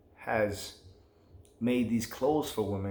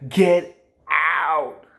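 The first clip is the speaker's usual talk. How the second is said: urgent warning shout; 5 semitones higher, 9 dB louder than usual. Real, not acted. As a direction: shout it high and loud. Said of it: "repeated warning, louder".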